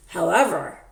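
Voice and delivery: usual speaking voice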